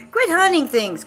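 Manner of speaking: loud, high scolding of the cat; a cat owner snapping at her pet; scolding yell. Real, not acted. Scolding the cat again, louder.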